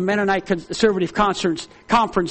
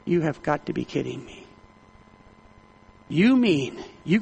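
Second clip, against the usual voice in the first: low, much softer disbelief; hushed and stern, can't believe it; quiet stern disbelief to the church man; quiet rebuke; 6 semitones lower, 7 dB quieter than usual. Retold or imitated.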